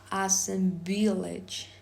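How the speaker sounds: ordinary talk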